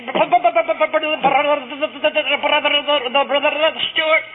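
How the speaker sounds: heavy machine-gun stutter on each word; imitating a severe speech impediment. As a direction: splutter like an old car failing to start. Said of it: "he spluttered and stuttered like an old Ford".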